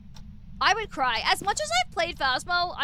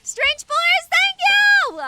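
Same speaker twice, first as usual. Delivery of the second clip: excited squeal; 12 semitones higher, 10 dB louder, slower than usual. Real, not acted. Squeals thanks for gift.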